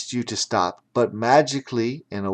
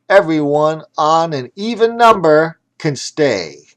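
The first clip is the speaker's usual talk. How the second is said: loud yelled ref call; ref yelling; 4 semitones higher, 6 dB louder than usual. Acted. The ref yells the rules at all the teams, loud. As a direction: call it out loud across the field. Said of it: "The ref yelled out".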